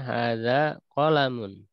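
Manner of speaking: slow model, qalamun with a short first a, ka-la-mun. Shown as a demonstration. Teacher models qalamun for the class, slow and short. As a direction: say qalamun clipped, no long vowel. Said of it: "kolamnya pendek bacanya ya".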